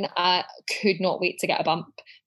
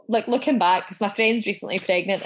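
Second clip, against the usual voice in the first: ordinary talk, nothing marked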